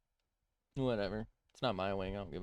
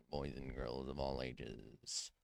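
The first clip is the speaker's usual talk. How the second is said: quiet mock announcer; 7 semitones lower, 5 dB quieter, slower than usual. Announcer trailing insult.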